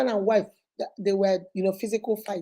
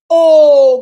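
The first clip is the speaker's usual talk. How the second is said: very loud drawn-out oh; loud gasp of shock; 5 semitones higher, 17 dB louder than usual. Retold or imitated. Brother hearing news, loud shocked oh. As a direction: bellow the oh in shock.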